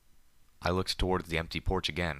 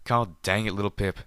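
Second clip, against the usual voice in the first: louder, higher angry growl; 3 semitones higher, 6 dB louder than usual. Acted. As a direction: growl it at her in frustration. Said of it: "Calamity growled back angrily".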